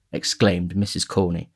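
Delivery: usual speaking voice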